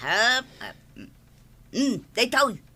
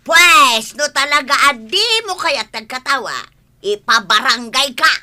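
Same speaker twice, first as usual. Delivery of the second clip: loud threat; 2 semitones higher, 8 dB louder than usual. Acted. Threatening to report her.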